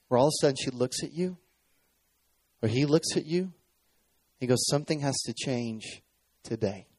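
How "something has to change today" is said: grave verdict. Retold or imitated. Doctor's grave verdict, retold.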